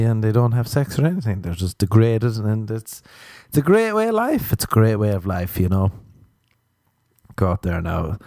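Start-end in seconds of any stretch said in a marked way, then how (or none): 3.5-4.5 sarcastic high praise. Pretend. Mock sarcastic enthusiasm.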